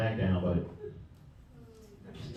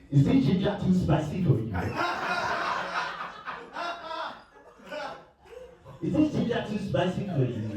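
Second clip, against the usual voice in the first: put-on African accent in bed; 13 semitones higher than usual. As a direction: tease your partner in the accent. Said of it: "No, in the accent".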